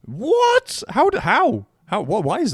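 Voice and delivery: high squeaky stunned what; shocked disbelief. Real, not acted. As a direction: shriek it in total shock. Stunned reaction to Brazil guess.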